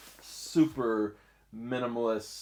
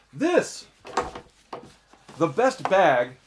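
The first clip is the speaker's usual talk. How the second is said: much higher, rising "this"; excited reveal; 6 semitones higher, 6 dB louder, slower than usual. Real, not acted. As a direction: unveil it with a bright lift. Reveals the tote.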